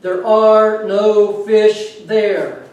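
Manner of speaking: loud, very slow booming voice from above; a hammed-up voice from above, loud and very slow. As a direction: boom it down from the sky. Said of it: "a big booming voice".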